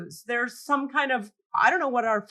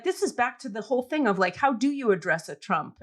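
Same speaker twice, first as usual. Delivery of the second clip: normal talking voice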